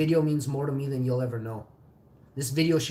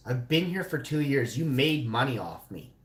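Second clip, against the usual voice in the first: ordinary talk, nothing marked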